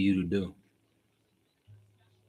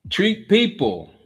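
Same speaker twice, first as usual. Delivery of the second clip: lightly voiced slow ad pitch, cut short; commercial voice; 10 semitones higher, 5 dB louder, slower than usual. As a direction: start the slow announcer read. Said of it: "that's my commercial voice".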